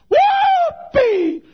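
very high, loud drawn-out yell; joyful whoop. Retold or imitated. Preacher finding a new truth on an airplane, joyful whoop. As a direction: let out a re-enacted holler of delight.

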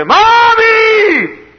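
very high, loud, drawn-out yell; lost child's scream. Retold or imitated. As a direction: scream for mother as a lost kid. Lost child calls mother, retold.

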